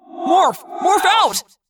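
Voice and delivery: high, loud shouted command; urgent yell. A narrator's reading. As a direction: yell it to save your friends. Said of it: "Jake yelled in my head".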